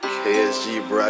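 ordinary delivery

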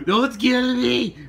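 high, loud, slow pleading cry; playful fake panic; mock terror. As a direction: beg for your life, hammed up. Fool begs for his life.

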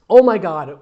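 loud burst on the first word; mock-excited outburst; acted exclamation. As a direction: exclaim it, then let it fall away. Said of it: "we exclaimed".

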